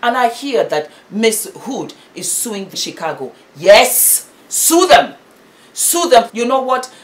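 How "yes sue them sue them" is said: high, loud cry of sue them; emphatic shout. Real, not acted. Vlogger cheering on a mother to sue, loud and high. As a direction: urge her loudly to sue. Fired-up commentary urging lawsuit.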